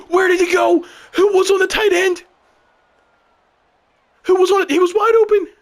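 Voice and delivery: high-pitched disbelieving yell; outraged shouting. Real, not acted. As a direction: shout in outrage at a blown play. Outburst as defense blows coverage.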